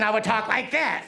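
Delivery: lightly disguised prank-call voice; The Blank. Retold or imitated. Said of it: "I used to call him up".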